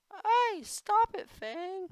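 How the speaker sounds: shrill high-pitched protest; squeaky high voice. Acted. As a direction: squeak at the dog to stop.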